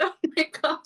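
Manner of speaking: ordinary delivery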